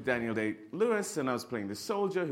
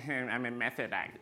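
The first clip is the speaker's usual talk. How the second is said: pretentious actor voice. Retold or imitated. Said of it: "idea of being a method actor".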